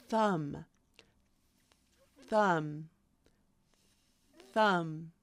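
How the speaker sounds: "thumb" starting on a voiceless th; unvoiced TH. Shown as a demonstration. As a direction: begin "thumb" with pure air, no buzz. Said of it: "because it's not voiced".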